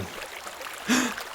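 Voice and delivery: sharp, breathless gasp of fright; frightened gasp. Acted. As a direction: gasp as if you saw a monster. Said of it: "cried Fernand in fright".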